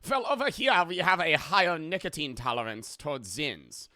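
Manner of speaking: boastful cartoon German accent. Shown as a demonstration. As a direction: condescend in a hammed-up German accent. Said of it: "Do the German accent".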